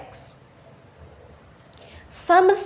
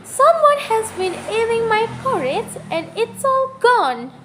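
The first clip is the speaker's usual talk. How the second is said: much higher little bear voice; pitched-up tiny baby bear; Baby Bear's wee little voice; 8 semitones higher than usual. A narrator's reading. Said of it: "Baby Bear said in his wee little voice".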